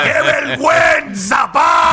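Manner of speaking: slow, high, loud mock outrage; mock shout. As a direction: drag it out like a scandalized shout. Teasing after a private secret.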